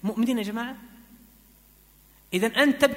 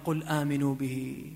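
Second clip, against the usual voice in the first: "قل آمنوا به" spoken softer and lower, not chanted; soft spoken verse; 8 semitones lower, 6 dB quieter, slower than usual. Acted. Preacher softly quotes the verse to his dear brother. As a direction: murmur "قل آمنوا به" gently to one listener.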